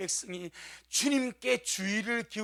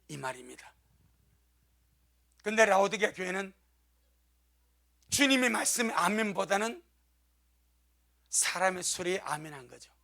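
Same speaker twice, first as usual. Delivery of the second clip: normal talking voice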